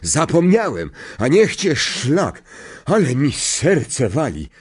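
high-pitched mocking echo, then rattled; indignant conductor. Stan repeats Harry's excuse after a fright, indignant. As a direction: throw the word back in shaken indignation.

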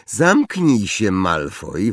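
plain reading voice

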